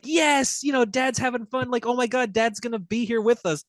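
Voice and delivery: put-on high, eager child voice; excited little kid. Little Normie cheering that his dad is home, high and eager. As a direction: bounce up high like a thrilled six-year-old. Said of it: "their son, is like".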